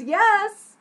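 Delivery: emphatic delighted affirmation; excited yes. Shown as a demonstration. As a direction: cheer it as a big yes. Shows excited meaning of really.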